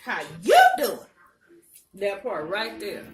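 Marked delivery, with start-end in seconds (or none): 0.0-1.0 loud, bright call-out; loud greeting. Real, not acted. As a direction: call it out to someone.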